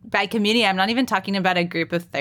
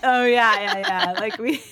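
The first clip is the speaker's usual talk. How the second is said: laughing; 6 semitones higher than usual. Real, not acted. Laughing at the cream cheese tease.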